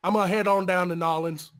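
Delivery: a hint of a lazy Southern drawl; light put-on Southern accent; mock Southern drawl. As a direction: play a good old boy heading to town.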